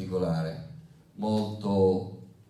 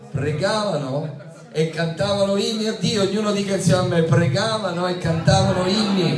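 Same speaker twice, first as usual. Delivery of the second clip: loud, high-pitched, forceful proclamation; impassioned preaching; 8 semitones higher, 6 dB louder than usual. Real, not acted. Preacher rousing congregation over Paul and Silas praying, impassioned and loud.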